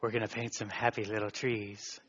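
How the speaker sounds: soft, low, hushed painter's murmur; a gentle TV painter crooning to his canvas; Bob Ross voice. Retold or imitated. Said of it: "He talks like this".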